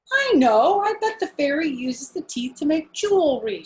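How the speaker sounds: high, loud burst of sudden inspiration; hammed-up eureka as Mother; mother's bright idea. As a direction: pop up high like a lightbulb went on.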